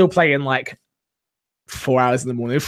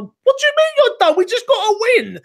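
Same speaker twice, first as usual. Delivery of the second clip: much higher, louder incredulous protest; exasperated disbelief; 3 dB louder than usual. Retold or imitated. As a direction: squeal it like you can't believe she'd quit. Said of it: "I'm like".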